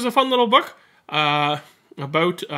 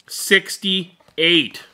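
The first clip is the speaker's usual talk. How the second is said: determined; 3 semitones higher, 7 dB louder than usual. Acted. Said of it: "with a determined stare".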